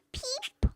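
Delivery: very high tiny peep; chick peep. A narrator's reading. Little chick greets the truck, very high peep. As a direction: squeak it like a baby chick. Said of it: "her chick said".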